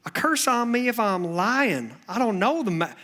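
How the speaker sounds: hammed-up Southern accent from Alabama; bad Alabama accent. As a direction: swear it in a badly faked Alabama accent. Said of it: "I know, that's bad. That's terrible".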